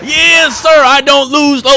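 high, loud victory yell; triumphant shout. Real, not acted. Winner boasting about the ones, high triumphant shout. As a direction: bellow the boast, stretching the end.

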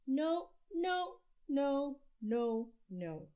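put-on a child shouting no over and over; emphatic no's. A narrator's reading. Child refusing his father, loud stubborn no's. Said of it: "Andrew said".

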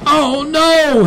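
high, dismayed cry; screaming kid. A narrator's reading. Kid sees Dad is overboard, screaming. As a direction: wail it in alarm. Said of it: "the kid screamed".

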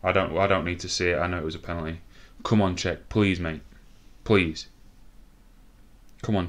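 ordinary talk, nothing marked

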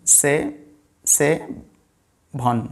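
সে and ভন said apart with long pauses; syllable by syllable. Shown as a demonstration. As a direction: break সেভন into two sounds, সে, then ভন. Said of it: "এখানে দুটো ভাওয়াল sound আছে".

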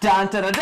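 dramatic dun dun dun. Pretend. Dramatic fanfare before first candle.

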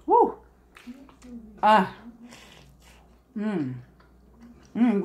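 ordinary talk, nothing marked

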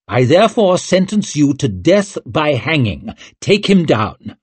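high, forceful royal command; stern sentencing. A narrator's reading. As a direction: pronounce doom, then order him away. King pronounces a death sentence.

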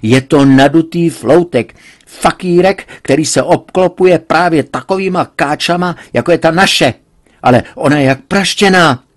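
father railing at the daughter's suitor; heated insults. A narrator's reading. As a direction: spit out the insults. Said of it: "užít silných nařčení".

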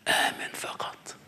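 breathy whisper of آمن فقط, barely voiced. Shown as a demonstration. Preacher drops to a hush on the verse, whispering. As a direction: let it fall to a hush.

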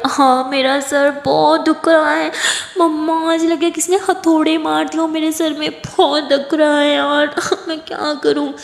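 hangover wailing. Acted. Hungover Aditi wails loudly to Kiran about her headache. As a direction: wail like the head is splitting. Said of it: "ज़ोर ज़ोर से चिल्ला रही है".

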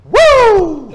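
high, loud wrestler's whoop; piercing showboat "woo"; Ric Flair. Acted. Performer capping the requested impression, high wrestler whoop. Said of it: "do a little Ric Flair".

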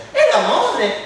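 teasing question. Acted. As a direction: needle him with it. Quarrel with group. (domanda provocatoria, acuta)

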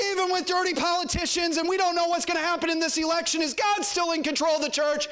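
high, ringing preacher's challenge; urgent, high-pitched rhetorical question; rousing preaching. Real, not acted. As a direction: call it out to rally the congregation. Preacher rallies congregation with questions.

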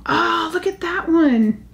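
high, slow, drawn-out admiration; delighted. Real, not acted. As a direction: marvel at the piece. New sparkly piece spotted.

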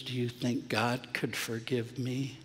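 a hushed, broken plea from dad; tearful question. Retold or imitated. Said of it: "he was crying".

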